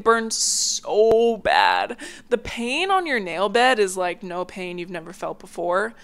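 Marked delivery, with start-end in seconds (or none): 0.0-2.0 drawn-out complaint. Real, not acted. Recalling nail pain.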